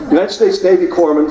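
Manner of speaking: ordinary talk, nothing marked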